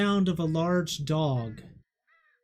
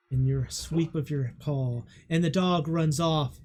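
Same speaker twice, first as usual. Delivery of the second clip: usual speaking voice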